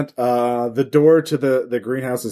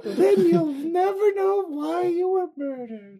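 high silly character voice; 4 dB quieter, slower than usual. Acted. Same character, ominous line.

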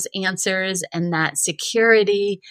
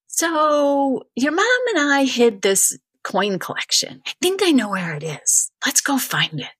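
lightly voiced dad; 8 semitones higher than usual. Dad voiced in retold story.

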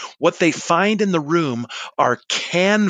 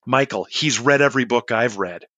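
ordinary delivery

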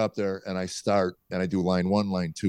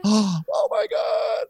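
very high, drawn-out disbelief; imitating an overcome wife; overwhelmed, near tears; much slower than usual. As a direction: stretch it out in a high, tearful wail. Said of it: "then she starts crying".

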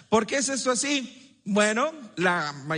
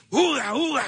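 short, higher, louder caveman grunting; 4 semitones higher, 3 dB louder than usual. Retold or imitated. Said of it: "una mentalidad como esta".

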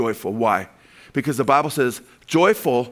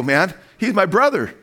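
normal talking voice

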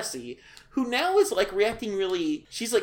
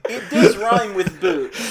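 laughing; 5 semitones higher, 8 dB louder, slower than usual. Real, not acted. Laughing while explaining.

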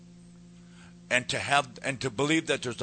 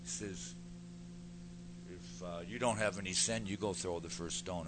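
Jesus speaking quietly; 3 semitones lower, 9 dB quieter than usual. Retold or imitated. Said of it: "And Jesus says".

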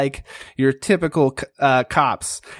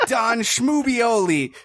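higher, pushy interrogator; gruff bad cop; 8 semitones higher than usual. Pig cop Porkmeister grilling the frog, pushy and higher. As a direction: lean on the suspect hard. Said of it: "a lot more gruff".

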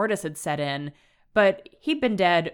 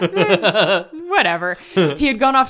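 laughing; 2 semitones higher, 8 dB louder, slower than usual. Real, not acted. Laughing after being corrected.

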